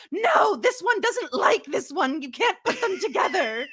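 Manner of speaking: high, loud mock screaming; hammed-up frantic protest, shrieked; Moira. Podcaster imitating Moira packing her wigs, shrieking theatrically. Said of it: "like the screaming and the wigs".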